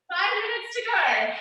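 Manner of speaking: normal talking voice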